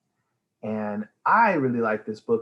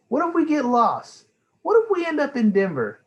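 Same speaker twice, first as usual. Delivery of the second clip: put-on higher, fast worried child voice; 6 dB louder than usual. Amelia anxious questions.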